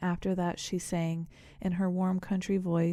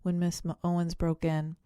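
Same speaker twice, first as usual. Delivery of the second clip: ordinary delivery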